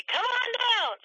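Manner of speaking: game-show announcer hamming it up; radio announcer. Shown as a demonstration. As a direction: belt out "Come on down" like a radio ad. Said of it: "that you hear them do".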